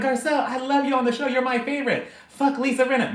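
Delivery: high, excited re-enacted gushing; gushing fan. Re-enacts gushing to her idol.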